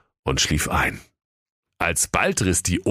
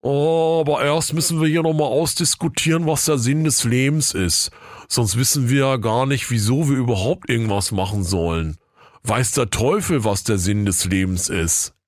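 weary villager; 6 semitones higher than usual. A narrator's reading. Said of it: "sagte einer der Dorfbewohner matt".

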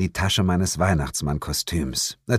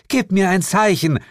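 much higher, louder pleading call; desperate calling; 12 semitones higher, 5 dB louder than usual. Acted. A woman calls desperately for her friend, loud and high. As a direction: call out frantically for someone lost.

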